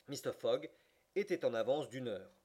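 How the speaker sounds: plain reading voice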